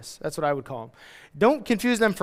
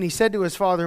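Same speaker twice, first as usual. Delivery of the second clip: normal talking voice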